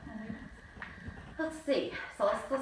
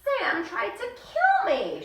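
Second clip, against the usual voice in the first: much higher, slow, laughing; tattling yell; 13 semitones higher, 3 dB louder, slower than usual. Acted. A girl tattling to the class, yelled much higher and laughing. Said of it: "she yelled".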